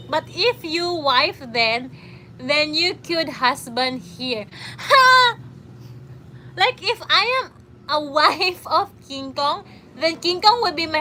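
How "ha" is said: sharp high loud Ha; one loud bark of laughter; loud laugh burst. Real, not acted. Laughing at own joke.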